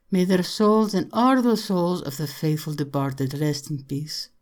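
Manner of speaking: put-on Irish girl's voice in solemn blessing; prayerful Irish girl. Irish girl toasts the dead with a blessing, prayerful.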